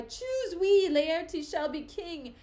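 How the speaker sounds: loud, high rallying cry; crowd's cry. Acted. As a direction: proclaim it like a cheering mob. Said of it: "they cry".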